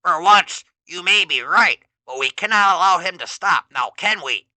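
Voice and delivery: mock the underling; Skeletor sneering. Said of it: "Skeletor responds".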